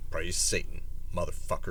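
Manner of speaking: low muttered aside; deadpan mutter. Pretend. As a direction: mumble it under your breath. Muttered ritual line.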